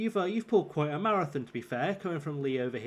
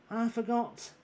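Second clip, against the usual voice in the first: put-on Essex accent; 7 semitones higher, slower than usual. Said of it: "We were looking for Essex".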